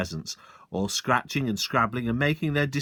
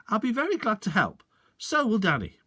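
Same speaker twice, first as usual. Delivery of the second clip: much higher, brightly agreeable; cheery father; 10 semitones higher than usual. Acted. Father cheerfully agreeing to help the sergeant, much higher. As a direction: agree with a sly twinkle.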